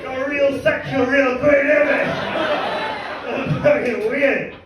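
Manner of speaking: normal talking voice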